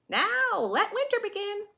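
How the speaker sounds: high, loud festive toast; high excited toast; cheery toast. Acted. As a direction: raise a glass and cheer. Animals toast the winter.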